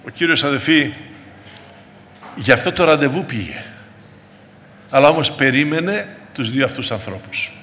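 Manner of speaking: usual speaking voice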